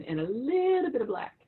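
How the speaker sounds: high swooping lilt on "little"; mom's drawn-out phrasing. Retold or imitated. Daughter quoting mom's answer about their ancestry, drawn-out singsong. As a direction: stretch "little", sliding up high. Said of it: "she would always say it like that".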